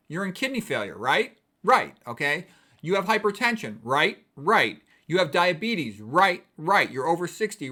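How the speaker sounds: drilling the risks into her; loud lecturing. Retold or imitated. Doctor pressing a patient on her risks, loud and firm.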